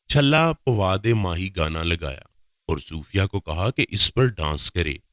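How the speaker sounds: ordinary delivery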